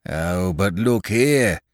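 slow mock growl of an old farmer. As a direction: growl the objection, slowly. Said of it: "growled Farmer Goussot".

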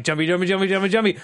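excited bunny. Retold or imitated. Host imitating how the creature's name sounded, excited bunny. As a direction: chant it like an excited little bunny. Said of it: "like an excited bunny".

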